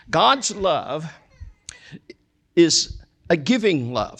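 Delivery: ordinary talk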